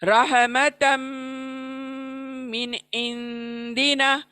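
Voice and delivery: slow chant of रहमतम मिन इंदिना, मिन clear, इंदिना nasal; tajweed recitation. Shown as a demonstration. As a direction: recite slowly, clear नून then hidden नून. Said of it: "मिन को fast पढ़ रही हूँ".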